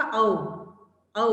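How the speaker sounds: औ held as an open aw; teacher showing the औ sound; au sound demo. Shown as a demonstration. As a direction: model the औ vowel clearly. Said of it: "औ की sound आती है".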